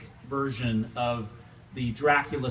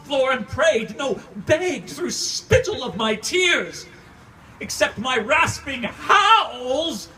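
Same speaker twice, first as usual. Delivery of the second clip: loud, higher, broken by cries; anguished sobbing shout; 12 semitones higher, 7 dB louder, slower than usual. Acted. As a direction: howl it through tears. Grief outburst while beating the floor.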